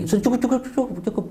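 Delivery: high, rapid-fire mock plea to stop; panicky urging. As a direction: imitate someone urgently calling a halt. Menirukan orang menyuruh segera berhenti. (seruan panik bernada tinggi)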